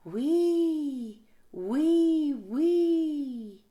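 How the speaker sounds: a comic wheezy snore; whistling snore. Acted. Narrator voices Big Mouse snoring.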